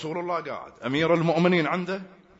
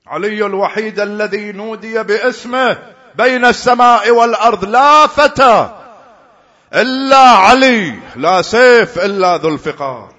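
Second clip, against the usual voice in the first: preaching at full force; 8 semitones higher, 13 dB louder than usual. Real, not acted. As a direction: proclaim Ali's glory to the crowd. Preacher extols Ali at full force.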